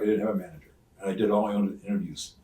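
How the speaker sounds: ordinary talk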